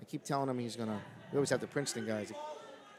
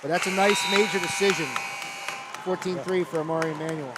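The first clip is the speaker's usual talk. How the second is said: loud, raised commentator voice; excited result call; 4 semitones higher, 7 dB louder than usual. Real, not acted. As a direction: announce the win with real energy. Announces the match result.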